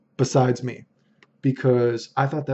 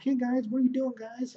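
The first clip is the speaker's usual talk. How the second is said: high, softer mock voice; Josh; 11 semitones higher, 7 dB quieter than usual. Player greets the busy team, high-pitched mocking impression. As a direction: pitch it way up to mimic an uninvited tagalong. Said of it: "I think that's how he's like".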